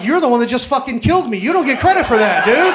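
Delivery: loud, laughing disbelief; outraged, laughing protest at the smug doctor; exasperated outburst. Retold or imitated. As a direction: yell it back at the doctor, half laughing.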